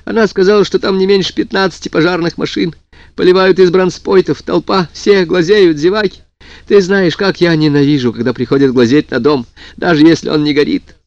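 tearful and rushed; crying on the phone. A narrator's reading. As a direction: sob it out over the phone. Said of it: "всхлипывала она в трубку".

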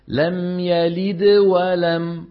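very slow لم يلد ولم, the د of يلد bounced lightly and flowing straight into ولم; slowed-down لم يلد ولم with a light د linking onward; minor qalqala demo. Shown as a demonstration. Teacher showing students the lighter qalqala in connected speech. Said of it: "أنا نطقت الدال ومباشرة غيرت شكل فمي".

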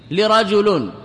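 لرجل begun with a short "i", "li-rajulun"; kasra on lam. Shown as a demonstration. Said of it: "إذا قرأت ووجدت الكسرة".

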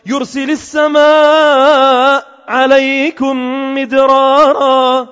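slow melodic chanting of the verse; Quran recitation. Acted. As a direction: chant the verse slowly with tajweed. Quran recitation within sermon. (تلاوة قرآنية مرتلة ببطء)

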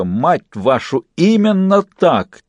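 put-on slow, drawn-out Dock accent. A narrator's reading. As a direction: curse exultantly in a Dock accent. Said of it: "выговорил паяц с сильным акцентом Дока".

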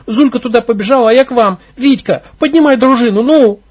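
high, loud rushed pleading; girl urging the leader to rally the gang; urgent breathless report. Acted. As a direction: hurry them to act.

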